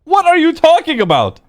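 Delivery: high, baffled protest; indignant disbelief. Acted. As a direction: squeak out your innocence. Accused friend protesting innocence.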